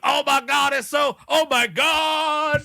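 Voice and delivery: mock ecstasy. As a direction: cry out in hammed-up passion. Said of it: "Were you having sex with a woman?".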